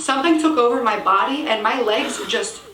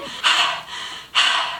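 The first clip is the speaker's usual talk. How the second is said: heavy voiceless puff; out-of-breath exhale. Retold or imitated. Winded jogger breathing on the woman, heavy mock exhale. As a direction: imitate panting hard after a run. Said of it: "I'm still out of breath from the run".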